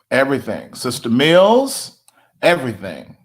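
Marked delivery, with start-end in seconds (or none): 0.7-2.0 calling out. Real, not acted. Calling out congregant.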